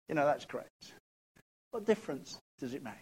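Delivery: usual speaking voice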